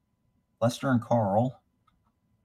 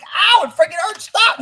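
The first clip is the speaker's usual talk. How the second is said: much higher, louder yelp of pain; mock pained cry; 6 dB louder than usual. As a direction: cry out as if being shocked. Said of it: "They're like".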